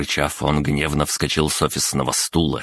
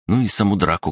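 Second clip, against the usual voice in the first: plain reading voice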